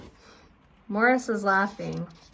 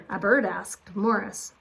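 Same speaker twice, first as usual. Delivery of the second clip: usual speaking voice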